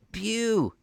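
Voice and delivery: high, loud call down from the window; crying out. Acted. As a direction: hail the man below. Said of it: "he cried".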